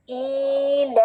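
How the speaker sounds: ईलाफिहिम recited with long held vowels; teacher's model Qur'anic recitation; Arabic recitation. Shown as a demonstration. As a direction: intone ईलाफिहिम on a level note. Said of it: "अपना accent भी Arabic रखेंगे".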